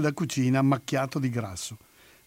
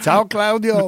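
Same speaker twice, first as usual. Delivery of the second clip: louder, higher mock farewell; playful call; 7 semitones higher, 7 dB louder, slower than usual. Joking goodbye to absent friend Claudio, playfully called out.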